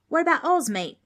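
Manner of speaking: put-on a kid from down under shouting his idea; thrilled young Australian; excited Aussie kid. Darby excited about Oz.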